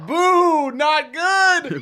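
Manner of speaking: loud high jeering shout; booing. Acted. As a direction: boo him loudly. Mock booing a teammate.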